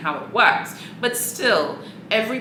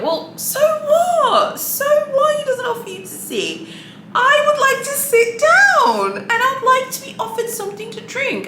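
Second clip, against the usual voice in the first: high and loud, slow on so what; righteous outrage, squeal it; indignant; 5 dB louder than usual. Real, not acted. Answering a review that she wasn't offered a seat, indignant.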